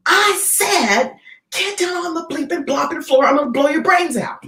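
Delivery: high, hammed-up gunman order; mock robber threat. Robber threatening customers third time, mock yell. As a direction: bark it like a robber.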